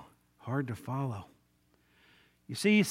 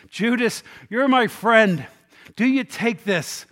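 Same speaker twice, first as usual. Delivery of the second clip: raised, loud plea to a friend; earnest appeal; 5 semitones higher, 7 dB louder, slower than usual. Acted. Jesus offering Judas the bread in friendship, appealing earnestly. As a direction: reach out to him, pleading with raised voice.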